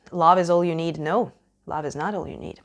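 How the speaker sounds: usual speaking voice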